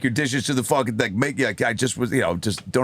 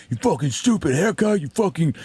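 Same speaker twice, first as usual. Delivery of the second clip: high, sputtering mock insult; irate old heckler; 7 semitones higher than usual. Old heckler mocking the comic's haircut, mock anger. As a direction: imitate an old man spitting out insults. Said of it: "he started making fun of my haircut".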